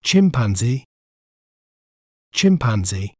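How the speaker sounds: "chimpanzee" said as a careful model, chim-pan-zee; "chimpanzee" given as a standalone dictionary-style example; careful pronunciation. Shown as a demonstration. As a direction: pronounce "chimpanzee" clearly as one whole word. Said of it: "We may pronounce it as".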